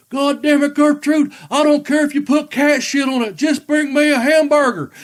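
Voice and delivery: loud, high exasperated holler; grandfather yelling. Retold or imitated. Grandfather snaps back at grandmother from bed, imitated loud yell. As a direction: bellow it like a cranky drunk grandfather. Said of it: "he'd yell it out again".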